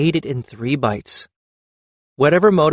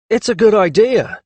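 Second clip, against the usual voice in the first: defensive; 9 semitones higher, 4 dB louder than usual. A narrator's reading. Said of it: "said defensively".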